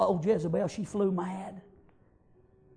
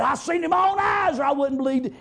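excited shout; 7 semitones higher, 4 dB louder than usual. Acted. Preacher voicing excited messenger.

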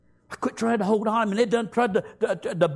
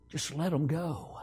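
low, much softer voice of the Lord; soft low voice of the Lord in the night; still small voice; 8 semitones lower, 8 dB quieter than usual. Retold or imitated. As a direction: speak gently low like God in your ear. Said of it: "he said in that still small voice".

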